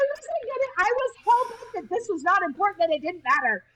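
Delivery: high, giggly laugh through the words. Real, not acted. To her podcast host, laughing while confessing. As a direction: laugh it out, embarrassed at herself. Laughing about not wanting to enjoy.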